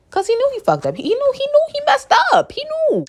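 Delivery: very high, worked-up storytelling squeal; high-pitched disbelief. Retold or imitated. Student telling how the teacher messed up, high-pitched disbelief. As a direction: squeal it high, repeating for emphasis.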